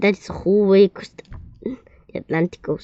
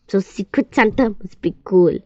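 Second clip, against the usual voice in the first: usual speaking voice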